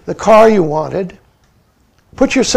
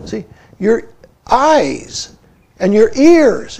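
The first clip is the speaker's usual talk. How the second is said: normal talking voice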